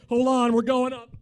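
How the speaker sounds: hammed-up loud pilot voice; mock pilot shout. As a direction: bark like a jet pilot on takeoff. Mocking the driver as a pilot.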